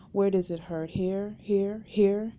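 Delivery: sugary, taunting, slow; sweet mocking voice. A narrator's reading. Cop mocking the injured prisoner, sweet mocking voice. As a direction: coo it with a sneer underneath. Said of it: "in a sweet, mocking voice".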